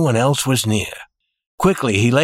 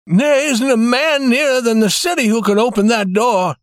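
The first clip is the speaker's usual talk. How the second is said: high, distressed shaking voice; 10 semitones higher than usual. A narrator's reading. Banker with no one to open the safe, shaking voice. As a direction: let fear lift and shake the voice. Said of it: "in a shaking voice".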